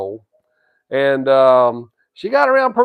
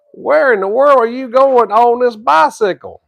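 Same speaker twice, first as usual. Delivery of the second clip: amazed question; 7 semitones higher, 3 dB louder than usual. Retold or imitated. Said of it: "I said".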